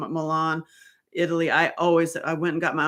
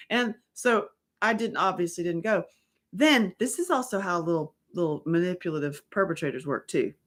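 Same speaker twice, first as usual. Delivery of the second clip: normal talking voice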